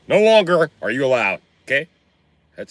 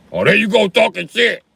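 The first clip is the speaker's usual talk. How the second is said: mock dad annoyed; 10 semitones higher, 5 dB louder than usual. Father voiced in argument.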